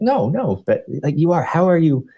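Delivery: a hint of a curious woman coworker; imitating a coworker.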